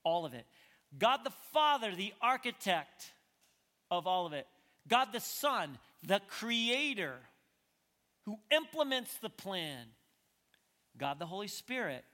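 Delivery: high, loud proclamation in short phrases; forceful preaching. Real, not acted. Preacher proclaiming Father and Son as creators, forceful preaching. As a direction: ring out each title with weight.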